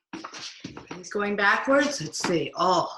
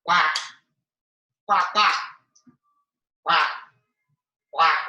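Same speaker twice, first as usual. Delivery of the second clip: short, loud imitation quacks; duck quacks; 4 semitones lower, 5 dB louder than usual. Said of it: "make a duck bill".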